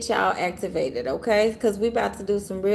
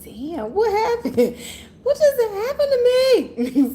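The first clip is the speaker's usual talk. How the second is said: dazed shock; 13 semitones higher, 5 dB louder, slower than usual. Retold or imitated. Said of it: "I be like".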